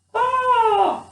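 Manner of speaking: loud, very high crow's caw. Acted. Crow greeting lost Gerda in the forest, loud high caw. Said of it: "he crowed".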